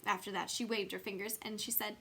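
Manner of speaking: usual speaking voice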